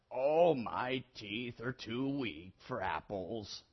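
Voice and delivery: put-on low, soft, slow old man's voice; feeble old man. A narrator's reading. Old boy tells tree his teeth are weak, feeble. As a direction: drag it out like a frail old man.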